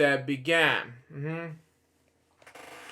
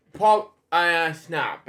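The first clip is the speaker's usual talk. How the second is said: ordinary delivery, nothing marked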